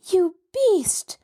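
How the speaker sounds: sharp high outraged cry. A narrator's reading. Nancy crying out at her captor in outrage. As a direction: fling the insult at him, high and sharp. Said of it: "Nancy cried".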